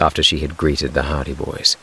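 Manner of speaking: plain reading voice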